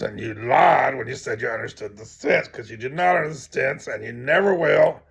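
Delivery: understated storyteller's version of a stern telling-off; mild scolding. Acted. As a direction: tell him off, driving the point home. Acted quote, woman rebukes the scholar.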